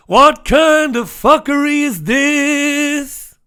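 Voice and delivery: high, loud, drawn-out cry; mock outraged outburst. Outburst cutting into the story.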